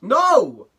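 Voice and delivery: loud, high shout of refusal; outraged protest. Real, not acted. YouTuber scolding an insensitive parent, loud outraged shout. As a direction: shout them down, appalled.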